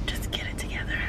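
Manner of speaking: very soft breath-only whisper; whispered self-talk. Real, not acted. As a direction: whisper it to steady yourself. Pep talk to herself, whispered.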